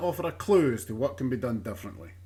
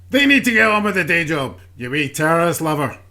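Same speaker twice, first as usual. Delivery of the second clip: loud, raised-pitch heckle, easing slightly; angry outburst; 9 dB louder than usual. Acted. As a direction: burst out loudly at the interviewer. Politician's outburst at the presenter.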